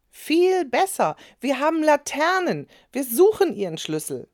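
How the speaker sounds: high, loud, eager offer; eager child. A narrator's reading. Girl eagerly offers to search for the neighbour's key. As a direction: jump in keen to help.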